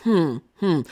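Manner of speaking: scheming inner voice. Retold or imitated. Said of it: "sitting there plotting".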